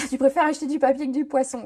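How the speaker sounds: laughing, quick read-out of a teasing comment; amused and giggly, rattled off. Real, not acted. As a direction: read it fast through a laugh. Laughing over a viewer's fish joke.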